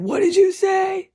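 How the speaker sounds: high, loud furious demand; retold shout of the stepfather; angry yell. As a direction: shout it at him in a rage. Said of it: "And he said".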